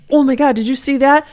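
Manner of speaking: high, loud put-on accent, meant as Arizona; hammed-up, off-target Arizona accent; failed Arizona accent. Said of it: "that's not an Arizona accent".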